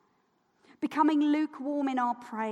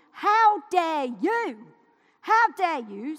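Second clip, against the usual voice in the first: slow, louder, stretched-out indignation; indignant; 3 semitones higher, 6 dB louder, slower than usual. Real, not acted. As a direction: drag out each word in outrage. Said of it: "I was filled with rage".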